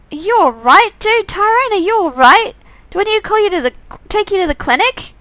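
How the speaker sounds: put-on girl asking a sick friend if he's okay; worried girl voice. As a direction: check on him, anxious and caring. Player character worried about Tyrone.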